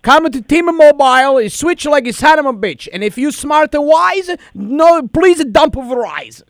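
prank caller in a thick put-on Italian accent. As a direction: play an Italian immigrant dad, hammed-up. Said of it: "but I got a lot of accent".

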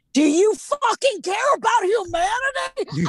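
high, furious retold shout; losing it. Woman re-enacting her blow-up at a maskless shopper, furious high shout. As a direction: yell it at full outrage, re-enacting a blow-up. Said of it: "lost my shit".

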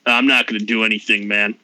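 a sleepy Aussie voice; Australian Alpha Chad. Acted. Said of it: "He takes over the mouth".

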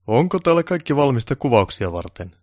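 self-important director voice; pompous director. Acted. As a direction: demand it like a big boss. Director asks on arrival.

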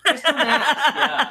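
high laughing squeal; giggling through the words. Real, not acted.

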